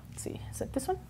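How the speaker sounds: whispered aside to herself. Real, not acted. Searching for the slide, whispered to herself. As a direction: mutter under the breath while finding the slide. Muttering to self at computer.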